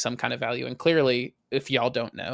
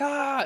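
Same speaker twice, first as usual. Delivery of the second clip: retold scream; 14 semitones higher than usual. Dad retelling his sting, mock high cry. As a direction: replay the yelp, held back in volume. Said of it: "hear you scream".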